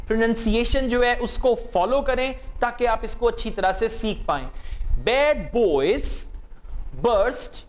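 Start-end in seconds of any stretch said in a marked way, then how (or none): 5.0-7.5 Bad boys, burst, the balloon said in separate chunks; chunked model sentence; split for repeating. Shown as a demonstration. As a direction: break it into pieces for the class. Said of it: "इन sentences को मेरे साथ-साथ repeat करें".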